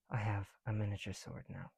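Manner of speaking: ordinary talk, nothing marked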